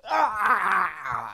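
loud, high drawn-out yell; big performed holler; acted yell. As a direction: reenact a full-throated yell for the camera. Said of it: "doing, like, a yell".

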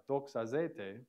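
ordinary talk, nothing marked

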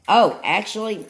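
oh, actually, a high, loud catch; surprised oh, actually; sudden realization. Real, not acted. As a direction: catch your own mistake mid-sentence. Realizes the lids are mixed up.

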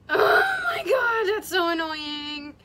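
high, loud cry then slow groaning complaint; shouting in dismay at a makeup slip; real dismayed shout. Real, not acted. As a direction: cry out, then drag out the annoyance. Another makeup mistake.

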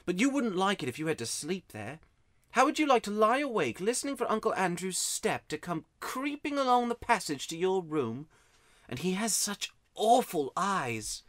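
put-on high-pitched boy telling of a creepy uncle; uneasy boy. A narrator's reading. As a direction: draw out the last words with dread. Narrator voices frightened Digory.

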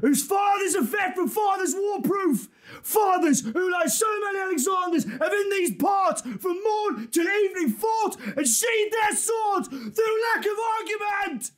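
high, full-throated war-speech shout; battle rallying cry. Acted. Commander urging English soldiers into battle, rallying shout. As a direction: roar the troops on into battle.

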